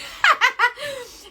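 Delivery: high, loud burst of laughter. Real, not acted. Cracking up during her makeup tutorial, laughing.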